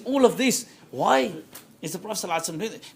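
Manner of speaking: ordinary talk, nothing marked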